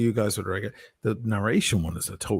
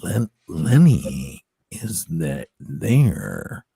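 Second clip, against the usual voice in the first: low, very slow monster growl; Lenny monster voice; 5 semitones lower, 3 dB louder, much slower than usual. Shown as a demonstration. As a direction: drag it out like a lumbering monster. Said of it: "into the monster".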